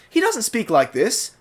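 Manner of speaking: British received pronunciation demo; British accent. Shown as a demonstration. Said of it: "I'm speaking in a British accent".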